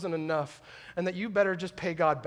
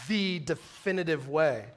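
slow, grave, measured declaration; lightly voiced Jesus, unhurried and weighty; a preacher quoting Jesus with slow gravity; solemn Jesus quote; 5 semitones lower, 5 dB quieter than usual. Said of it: "Jesus says".

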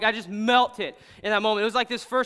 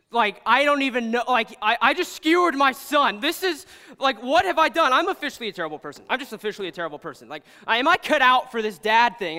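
higher, louder, rattled self-questioning; panicked dad; 4 semitones higher, 7 dB louder than usual. Retold or imitated. Dad horrified at hurting his son, acted-out panic. Acts out dad's panicked thoughts.

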